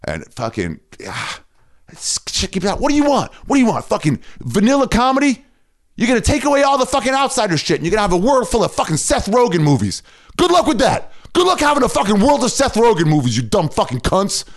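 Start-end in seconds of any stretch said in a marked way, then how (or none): 2.8-14.4 high-pitched, exasperated tirade; worked-up, incredulous outburst; angry rant. Real, not acted. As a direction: rail at the critics in real outrage. Ranting at critics of edgy comedy.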